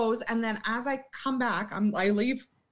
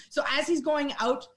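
usual speaking voice

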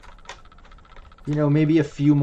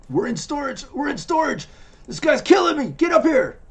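high frantic yelling guard; panicked shout; 13 semitones higher than usual. Acted. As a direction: yell in panic for backup. NPC shouting through radio.

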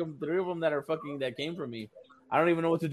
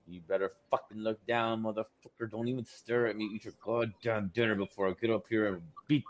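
low, soft, menacing growl; a hushed, menacing dad threat; a mock warning from a father to his staring son; 6 semitones lower, 7 dB quieter than usual. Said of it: "I'm like".

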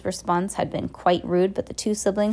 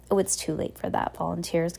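plain reading voice

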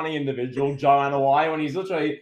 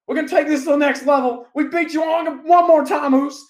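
high shouted wrestling promo; hammed-up battle cry, loud and pitched way up; Jey Uso; 11 semitones higher, 6 dB louder than usual. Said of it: "Jimmy or Jey Uso's like".

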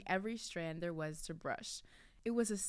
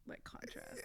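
whispered; 15 dB quieter than usual. Acted. Whispered aside while reading.

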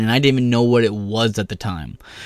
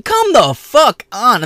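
much higher, louder, slow disbelieving yell; exasperated outburst; 12 semitones higher, 7 dB louder, much slower than usual. Real, not acted. Speaker fed up with whining friends, high slow yell. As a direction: drag it out in disgust.

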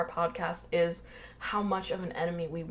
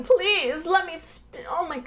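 high, whiny pleading; mock a coward begging for mercy; begging for my life; 8 semitones higher, 4 dB louder than usual. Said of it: "he's begging for his life".

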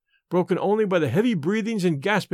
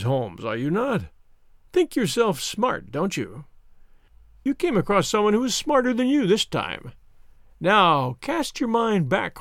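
Mister Smith, taunting; 3 semitones higher than usual. Acted. Visitor mocking Holmes's brains, taunting. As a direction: relish outsmarting him, stretching the words.

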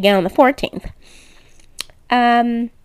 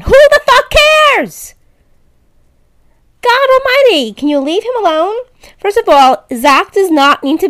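exasperated outburst; 9 semitones higher, 4 dB louder than usual. Real, not acted. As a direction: blow up at the fans in disbelief. Exasperated rant about tweets.

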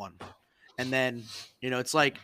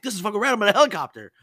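pumped and pitched up; thrilled; 6 semitones higher, 5 dB louder, faster than usual. Retold or imitated. Airman loving his first helicopter ride, excited and higher. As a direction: gush like it's the coolest thing ever.

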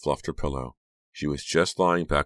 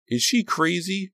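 very high, incredulous question; indignant interruption; 3 dB louder than usual. A narrator's reading. Becca interrupts Trish about Honey, indignant and high. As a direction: cut in, pitch soaring in disbelief. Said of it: "Becca interrupted".

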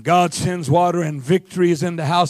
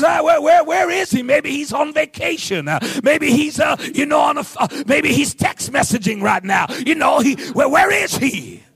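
higher-pitched taunting imitation; mocking; 5 semitones higher than usual. Elijah to Baal's prophets about their silent god, mocking. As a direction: poke fun with pretend puzzlement. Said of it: "He starts mocking them".

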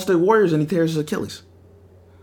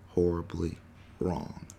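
ordinary talk

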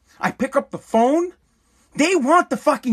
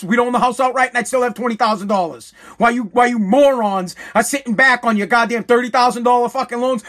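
ordinary delivery

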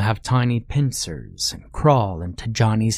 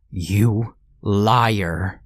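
usual speaking voice

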